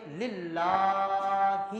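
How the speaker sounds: للہ held very long; slow reverent recitation; drawn recitation. Shown as a demonstration. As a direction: chant للہ with a long madd. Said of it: "پھر کیسے پڑھیں گے".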